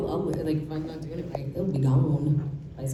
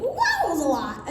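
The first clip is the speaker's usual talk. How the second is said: higher, overwhelmed little exclamation; a light, high "wow" of sensory overload; mock overwhelmed; 14 semitones higher than usual. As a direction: replay the moment of feeling swamped. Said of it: "'cause I was like".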